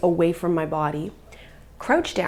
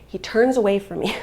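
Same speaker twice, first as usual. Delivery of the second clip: ordinary delivery, nothing marked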